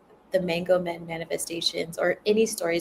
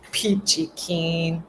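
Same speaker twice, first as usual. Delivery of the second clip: sarcastic; much slower than usual. Real, not acted. Sarcastic reaction.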